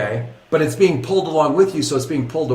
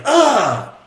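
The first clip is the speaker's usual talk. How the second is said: pained groan; 11 semitones higher, 4 dB louder than usual. Retold or imitated. Crowd knocked flat groaning.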